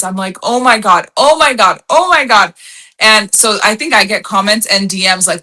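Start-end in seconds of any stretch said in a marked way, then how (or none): 0.4-2.5 re-enacted excited outburst, louder and higher; mock-thrilled, repeated with rising energy; excited exclamation. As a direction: exclaim it like a big win just hit. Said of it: "if I hit something exciting".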